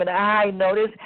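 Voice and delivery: ordinary talk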